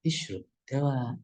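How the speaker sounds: softly, slowly coaxing; gentle fatherly tone. Shown as a demonstration. Father telling his sick child to take medicine, soft and slow. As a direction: urge it gently, taking your time. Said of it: "dengan nada yang lembut".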